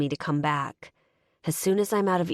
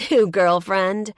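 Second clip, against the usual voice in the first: a slow, louder, scornful snort of a question; snorting jab; 4 semitones higher, 9 dB louder than usual. A narrator's reading. Ex-girlfriend mocking his new woman, slow and snorting. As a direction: sneer it out with contempt. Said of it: "she snorts".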